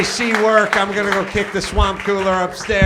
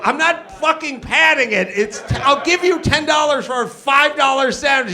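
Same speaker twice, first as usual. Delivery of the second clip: high-pitched acted indignation; exasperated outburst; 5 semitones higher than usual. Customer snapping back at advice to pad order, high and exasperated. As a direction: refuse the advice, voice pitched right up.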